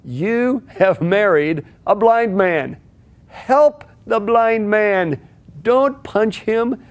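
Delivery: slow, deliberate, each phrase weighted; slow emphatic advice. Real, not acted. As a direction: drive the point home slowly. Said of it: "what I want to tell you".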